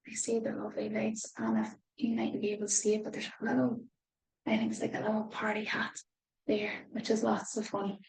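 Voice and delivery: soft, quick hushed aside; quiet. Real, not acted. Reader quietly pointing out the party hat.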